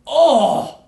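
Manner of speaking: loud, high cry of disgust; shocked cry. Real, not acted. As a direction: yelp in horror at the screen. Disgusted by the next scene.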